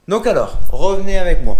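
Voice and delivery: loud call to come along. Pretend. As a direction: call everyone over loudly. Calling viewers back.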